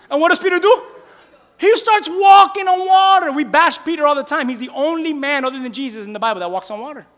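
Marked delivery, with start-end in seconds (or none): none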